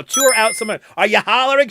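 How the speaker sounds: ordinary delivery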